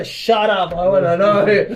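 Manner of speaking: put-on snapping shout at girlfriend; loud snapping retort. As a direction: shut her down and insist.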